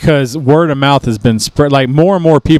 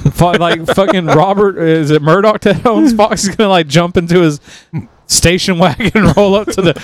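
ordinary talk

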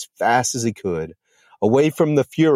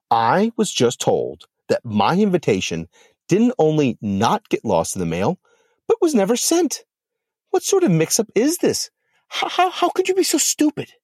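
much higher, rising indignant fury; outraged; 7 semitones higher than usual. Acted. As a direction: scold her friend, shrill with outrage. Lilith berates Medusa on phone.

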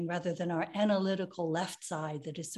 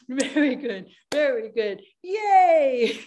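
cheering; 4 semitones higher, 8 dB louder, slower than usual. Shown as a demonstration. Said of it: "cheer after exercise".

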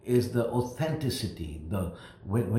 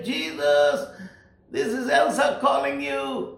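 screaming. Retold or imitated. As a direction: cry out with all her voice. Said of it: "she just kept on screaming".

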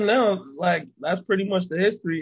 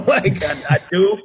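bursts out laughing, loud and high; 4 semitones higher, 8 dB louder than usual. Real, not acted. Laughing at himself while telling the interviewer. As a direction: laugh through the words, amused. Laughing about not caring.

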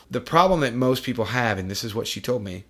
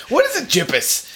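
high put-on baffled question; mock clueless voice; 14 semitones higher, 5 dB louder than usual. Host mocking someone baffled by GPS. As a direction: ask it like you have no idea.

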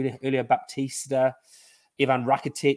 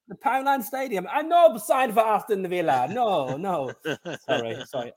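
mock Italian accent; 7 semitones higher than usual. Pretend Lazio player refusing to sign, mock accent. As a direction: lay on a vague Italian accent, apologising. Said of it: "what accent that was".

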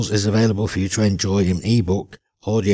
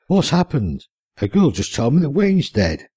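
higher, urgent alarm; 6 semitones higher than usual. Acted. Steve arriving at Wayne's bed, alarmed and higher. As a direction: rush the news out worried. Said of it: "Steve exclaimed".

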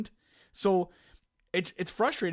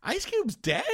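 much higher, a bit louder mock shock; mocking the wife; 11 semitones higher, 4 dB louder, slower than usual. Husband mimicking wife hearing [name] died. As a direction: squeak it out in disbelief.

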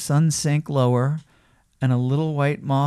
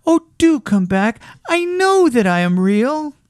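high, loud pleading rabbit; desperate little rabbit's plea; calling out; 10 semitones higher, 5 dB louder than usual. A narrator's reading. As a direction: beg them back in a raised small voice. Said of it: "called the little rabbit".

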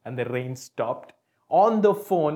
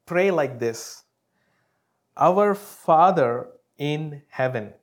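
scripture reading; 5 dB louder, slower than usual. Acted. Preacher to congregation, solemn slow scripture proclamation. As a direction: proclaim each word slowly.

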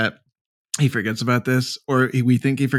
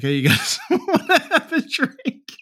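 imitating Goulian, laughing; slower than usual. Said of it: "where he's like".

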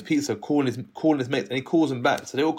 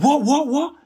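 much higher, louder amazed burst; amazed kids; 13 semitones higher, 5 dB louder, slower than usual. Retold or imitated. The gang hearing he's a rapper, a high surprised chorus. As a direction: gasp it out in disbelief.

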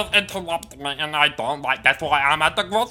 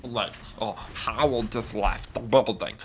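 muttered aside; 9 semitones lower, 7 dB quieter than usual. Acted. Grumbling aside about partner.

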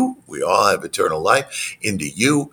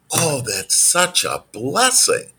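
delighted reassurance; 7 semitones higher, slower than usual. Retold or imitated. Coach retells her upbeat reply to upset client.

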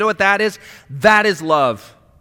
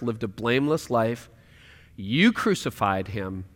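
tender voice of God; 8 semitones lower, 5 dB quieter than usual. Acted. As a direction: speak as a patient, loving Father. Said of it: "He says".

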